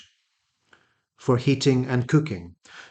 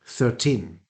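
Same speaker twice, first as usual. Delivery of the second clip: ordinary talk, nothing marked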